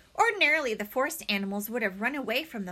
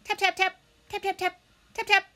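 tapping sound; 10 semitones higher, 4 dB louder, faster than usual. A narrator's reading.

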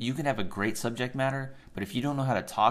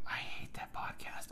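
whispered disgust; 11 dB quieter than usual. Real, not acted.

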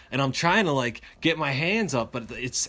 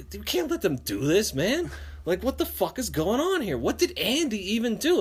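outraged friends; 8 semitones higher than usual. Retold or imitated.

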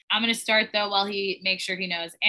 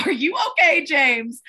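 mock concern; 5 semitones higher, 5 dB louder than usual. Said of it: "And I was like".

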